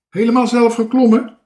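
high, loud call. A narrator's reading. Calling up to the boy in the tree, high loud shout. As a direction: shout the question upward.

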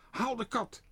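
hoge, luide roep; roepend. A narrator's reading. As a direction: roep het gehaast naar je vrouw. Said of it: "riep tegen zijn vrouw". (meneer Pardoes roept naar zijn vrouw, hoog en luid)

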